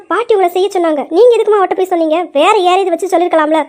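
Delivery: ordinary talk, nothing marked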